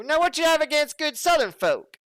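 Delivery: mock Southern accent; Southern accent impression. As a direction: play an offended Southern local. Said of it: "That's the last, uh, southerner impression I'll make".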